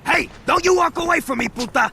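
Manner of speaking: high, loud furious yell; angry shout. Acted. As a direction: bark after her as she walks off. Yells after leaving woman.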